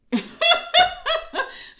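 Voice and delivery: loud squealing laugh; high loud laugh. Real, not acted. Laughing after the reveal.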